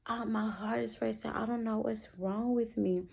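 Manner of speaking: softly acted, worried confession; quiet worry. Telling house mama her heart is racing, quiet worry.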